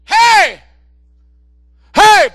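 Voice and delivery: loud, high urgent call; Pablo shouting. Acted. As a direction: stop him with a sharp shout. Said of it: "de repente grita Pablo". (Pablo grita al carcelero para detenerlo)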